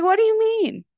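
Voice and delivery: as if the praise makes no sense; baffled question. Retold or imitated. Replying to her therapist, a surprised baffled question. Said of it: "And I was like".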